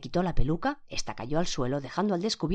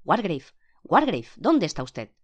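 calling out loud; 3 semitones higher, 7 dB louder than usual. A narrator's reading. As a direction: call out to someone who may not answer. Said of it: "llamó al juez en voz alta".

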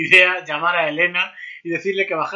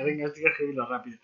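hurried mutter; 2 semitones lower, 7 dB quieter, faster than usual. Retold or imitated.

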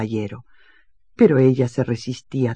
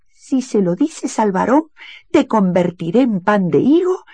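high, louder threatening cat voice; cat's threat; 7 semitones higher, 5 dB louder than usual. Acted. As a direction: scare the maid with a hissed threat. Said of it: "le susurró al oído". (amenaza de gato, voz aguda)

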